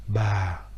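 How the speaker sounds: ba qui descend doucement; ba au ton grave. Shown as a demonstration. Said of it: "avec un accent grave". (ba avec accent grave)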